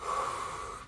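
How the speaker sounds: long, audible calming breath; demonstrated breath. Shown as a demonstration. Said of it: "Breathe in".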